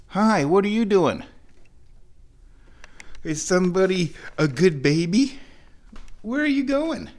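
talking to the cat. Real, not acted. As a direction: coo questions at a kitty.

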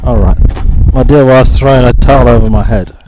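usual speaking voice

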